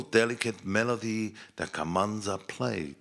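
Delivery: usual speaking voice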